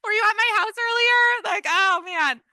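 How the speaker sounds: high, loud amused exclaim; delighted joking to the class; excited joking. Real, not acted. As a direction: play up the surprise. Joking with a participant.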